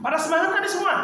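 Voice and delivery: semangat thrown high and bright; rally the class on semangat; high energetic question. Shown as a demonstration. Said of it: "intonasinya beda". (semangat diucapkan tinggi dan bersemangat)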